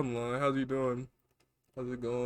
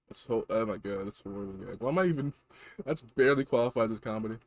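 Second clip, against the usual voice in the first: ordinary talk, nothing marked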